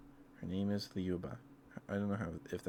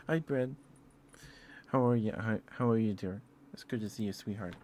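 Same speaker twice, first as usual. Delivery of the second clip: higher, louder doting hello; affectionate greeting; 3 semitones higher, 5 dB louder than usual. Real, not acted. Streamer breaks off to greet dear Brad, affectionately. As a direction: greet a sweetheart, pitched up and loud, then easing off.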